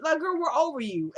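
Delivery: high-pitched, fed-up telling-off; exasperated dismissal. Real, not acted. Dismisses Annie to her face.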